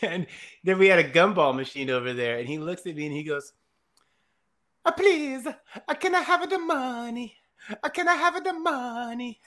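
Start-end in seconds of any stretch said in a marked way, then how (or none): 4.9-9.4 put-on very high, timid child begging; tiny high kid voice, slow and pleading; little kid voice. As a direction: squeak it like a small boy asking for coins. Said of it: "In his super high voice".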